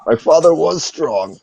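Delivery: high-pitched hammed-up whimpering protest; tearful wounded leader. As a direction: protest like a hurt, weepy warrior. Game master voices tearful enemy leader protesting.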